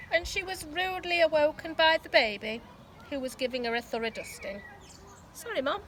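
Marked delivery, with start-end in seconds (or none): 5.3-5.8 mild yell. A narrator's reading. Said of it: "yelled Laura".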